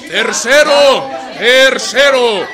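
loud, slow, high call; town-crier announcement; loud proclamation. Acted. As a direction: bellow the point out slowly. Proclaiming pact points.

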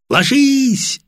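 high, loud shout; shouted warning. A narrator's reading. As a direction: yell the warning for cover. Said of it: "орёт Баранов вместе с командиром".

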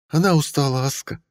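countess voice. A narrator's reading. Mariel answers Aska.

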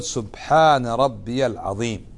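"سبحان ربي الأعلى" drawn out slowly and softly; slow, careful dhikr recitation; slow model recitation. Shown as a demonstration. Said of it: "وتقول".

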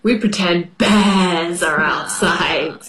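usual speaking voice

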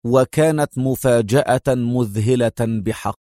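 plain reading voice

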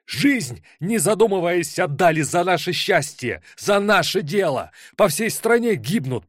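high, ringing, furious speech. Acted. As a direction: voice tight as a stretched string. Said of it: "Голос Жаркого зазвенел, как натянутая струна".

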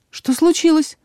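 startled, high-voiced query; surprised. A narrator's reading. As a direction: ask it in bewildered surprise. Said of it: "удивлённо спросила я".

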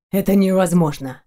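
understated lifeless reply; deadened voice. A narrator's reading. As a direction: answer as if drained of life. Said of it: "ответил он помертвевшим голосом".

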